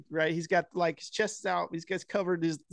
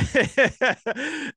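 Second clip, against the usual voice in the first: high burst of laughter; 8 semitones higher than usual. Real, not acted. Laughing while retelling sex scene.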